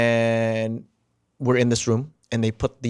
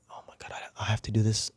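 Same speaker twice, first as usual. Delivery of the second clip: breathless panicked whisper; 3 semitones lower, 10 dB quieter than usual. Retold or imitated. Actor about to read his card, panicked whisper. Panicking inside before reveal.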